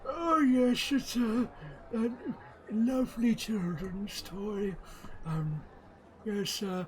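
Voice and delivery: put-on high, quavering old shopkeeper voice; a doddery old gnome selling toys; high old gnome voice, hesitant and fussy. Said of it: "the old man is like".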